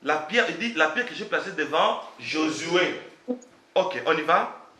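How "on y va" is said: usual speaking voice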